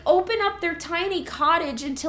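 ordinary talk

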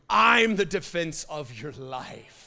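loud, slow, booming divine voice; roaring God. Retold or imitated. The Lord roaring over the fearful congregation, booming and slow. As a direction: roar it over them like God. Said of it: "I felt him roar".

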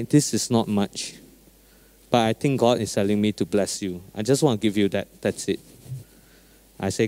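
soft, low, tender telling; gentle and sincere. Retold or imitated. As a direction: speak it softly like a kind pastor. Retold gentle words to driver.

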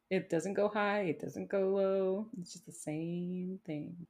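droning imitation, slowing toward the end; monotone. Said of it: "such a monotone voice".

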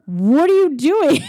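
high, loud exasperated yell; freaking out. Retold or imitated. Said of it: "he's freaking out".

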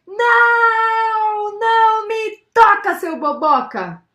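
high, loud, long-held "não"; shouted refusal. A narrator's reading. Girl shouting at the neighbour who touched her, shouted refusal. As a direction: yell it out, stretching the first word. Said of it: "se afastando e gritou pra ele".

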